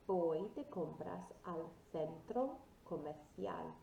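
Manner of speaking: slow, careful Spanish for learners; model pronunciation. Shown as a demonstration. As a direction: say it slowly, for a class to repeat. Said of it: "Repeat after me".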